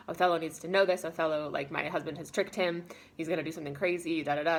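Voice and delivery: usual speaking voice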